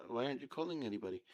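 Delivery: normal talking voice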